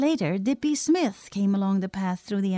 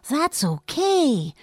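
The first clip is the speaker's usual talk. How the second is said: slow, higher-pitched approval, words stretched; slow appreciation; 5 semitones higher, much slower than usual. A narrator's reading. Boy admires his friend's supposed trick, slowly appreciative. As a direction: savor it, clearly impressed. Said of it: "said Dippy with slow appreciation".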